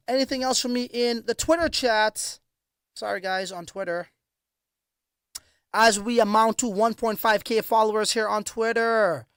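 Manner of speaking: ordinary delivery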